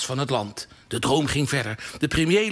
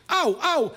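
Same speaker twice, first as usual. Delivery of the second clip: sudden high shriek of pain, ouch ouch; high painful squeal of auw auw; 11 semitones higher than usual. A narrator's reading. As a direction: yelp it out like a kid who kicked a hard ball. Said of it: "Hij krijste het uit".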